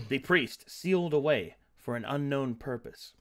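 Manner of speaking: game master recap. A narrator's reading. Game master recapping the last session, measured narration. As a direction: narrate the story so far with deliberate pauses.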